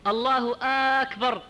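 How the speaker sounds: أكبر opening on a long "aa": aakbar; hamza stretched on akbar. Shown as a demonstration. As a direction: say الله, then drag the first vowel of أكبر. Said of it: "يدخل همزة الاستفهام على لفظ أكبر".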